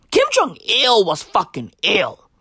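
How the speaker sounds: usual speaking voice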